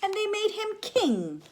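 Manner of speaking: very high, grand; soaring proclamation. A narrator's reading. Teacher reading the crowning, high and grand. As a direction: announce the king.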